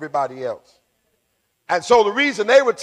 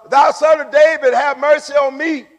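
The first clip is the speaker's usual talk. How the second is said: raised, higher plea; crying out; 7 semitones higher, 4 dB louder than usual. Acted. Blind man calls to passing Jesus, crying out. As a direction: call out for mercy with some force. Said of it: "he cried out the more".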